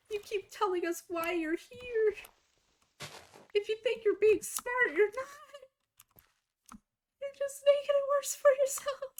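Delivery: high-pitched mocking lecture voice; mock scolding. Mocking the staff who scolded her, high voice.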